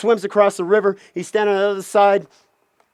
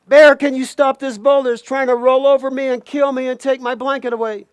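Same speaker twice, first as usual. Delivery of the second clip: raised pitch, urgent begging; panicked plea; 5 semitones higher than usual. Acted. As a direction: play a chased character calling for rescue. Chased Coyote begs bear for help.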